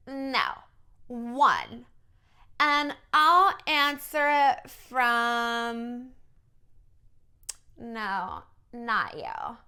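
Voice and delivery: put-on very slow Valley Girl brush-off; fake Valley Girl accent. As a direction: pick and reject people in a drawling Valley Girl voice. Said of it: "she has this weird Valley Girl accent".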